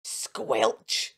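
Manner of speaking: usual speaking voice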